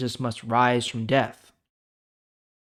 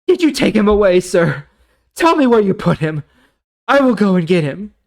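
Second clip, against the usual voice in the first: higher and louder, begging for the body; desperate Mary; 7 semitones higher, 10 dB louder than usual. Acted. Mary pleading with the gardener, high and desperate. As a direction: beg him to tell you.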